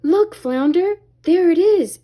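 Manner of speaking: excited shout across the water. A narrator's reading. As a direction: call out to a friend, pointing. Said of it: "Ariel shouted".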